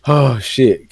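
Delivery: usual speaking voice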